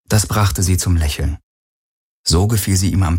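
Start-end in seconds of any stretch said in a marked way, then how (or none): none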